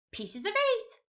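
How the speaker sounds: high squawky parrot. A narrator's reading. Narrator squawks as the other parrots, high parrot voice. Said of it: "Other parrots could say".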